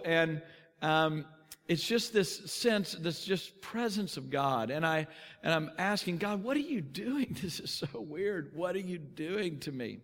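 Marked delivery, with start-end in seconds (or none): 6.2-10.0 soft, hushed, half-laughing wonder; awed prayer. Retold or imitated. Driver asks God what is happening, hushed and awed. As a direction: talk to God under your breath in awe. Alone in car, praying in awe.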